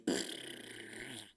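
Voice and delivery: comic puff of escaping air; mouth-made deflation sound effect; imitated deflating noise. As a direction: blow out a deflating sputter.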